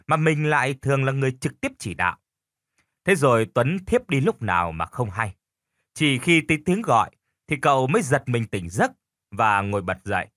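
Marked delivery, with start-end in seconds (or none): none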